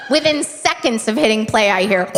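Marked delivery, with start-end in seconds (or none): none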